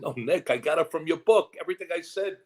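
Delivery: high-pitched, insistent retort; insist, pitching it up high; emphatic protest. Retold or imitated. Actor assuring the author it came from his book, emphatic protest.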